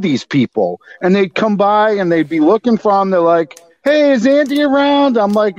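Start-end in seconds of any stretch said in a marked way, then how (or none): 3.8-5.2 mock stoner visitor.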